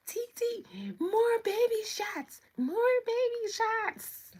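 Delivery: very high, loud mock child voice; excited little kids. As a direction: squeal it like kids begging, drawing the words out. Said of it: "And they were like".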